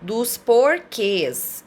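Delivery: porquês stretched long and slow, falling; teacher in front of students, dragging out the title: dos porquês slowly; drawn out topic word. Shown as a demonstration. Teacher stressing lesson topic.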